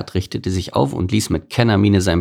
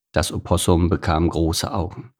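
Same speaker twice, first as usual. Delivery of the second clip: usual speaking voice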